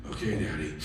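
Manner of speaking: low, soft, tiny reply; meek child. Retold or imitated. Son answering his intense dad, meek and quiet. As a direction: answer small and obedient.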